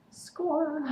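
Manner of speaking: a high little fan cheer. Real, not acted. Fan cheering Stefan's admission about Caroline, in a high happy cheer.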